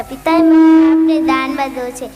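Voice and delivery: "પિતાએ મને આપને દાનમાં દો છે" called out loud at Yama's door; loud declaration. Acted. Nachiketa announcing himself at Yama's door, loud call. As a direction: proclaim it loudly to be heard. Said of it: "same words said earlier".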